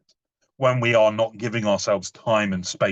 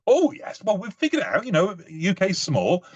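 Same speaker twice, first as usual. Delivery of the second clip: high-pitched, blithe assurance; breezy confidence; 7 semitones higher than usual. Retold or imitated. Tourist defending packed itinerary, blithely confident. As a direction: brush the doubt away, pitched up.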